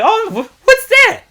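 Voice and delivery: loud, high-pitched startled question; mock surprised ignorance. Locals asked about Yakuza, feigning surprised ignorance. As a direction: jump up high and loud, playing clueless.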